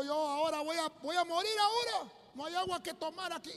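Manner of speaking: high-pitched mock complaint, not shouted; complaining to God. Preacher quotes Samson complaining to the Lord, high-pitched protest. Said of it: "estaba reclamándole al Señor".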